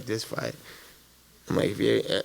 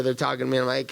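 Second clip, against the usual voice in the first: ordinary talk, nothing marked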